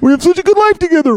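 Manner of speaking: high, loud, hammed-up weepy voice; mock teary husband with a laugh; mock tearful. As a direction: play it as half-laughing fake tears. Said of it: "tearing up".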